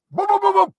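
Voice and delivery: high, loud dog-like boos; barking like a dog. Real, not acted. Man imitating a dog to scare the bird, loud high barks. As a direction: bark hard to chase it away. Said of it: "You gotta sound like a dog".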